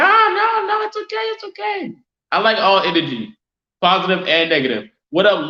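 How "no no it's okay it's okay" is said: sudden very high, squeaky no, no, it's okay; high yell. Real, not acted. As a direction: shoot way up into a falsetto yell. Streamer reacting to chat, high yell.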